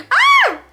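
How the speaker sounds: loud high-pitched squeal; sudden shocked yelp; startled shriek. Real, not acted. Startled outburst after reading DM.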